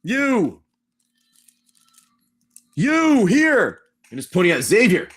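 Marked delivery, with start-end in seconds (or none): 0.0-3.8 loud, high-pitched call across a crowd; commanding call. Acted. Ghoul priest singles out Xavier in the church, commanding call. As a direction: summon someone sharply with long, high words.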